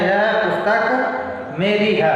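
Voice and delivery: slow, deliberate test reading of "यह पुस्तक मेरी है"; teacher sounding out "यह पुस्तक मेरी है" slowly; trying it out aloud. Shown as a demonstration. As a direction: read "यह पुस्तक मेरी है" slowly, word by word, to check how it sounds. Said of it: "अब हम इसको बोल के देखते हैं".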